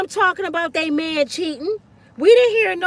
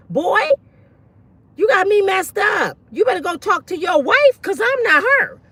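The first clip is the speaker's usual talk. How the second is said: shouting; 6 semitones higher than usual. Real, not acted. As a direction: tell him off at full voice. Scolding man on panel.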